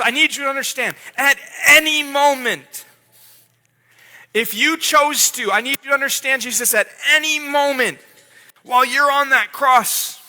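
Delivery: urgent appeal. Acted. God urging Jesus, rising intensity.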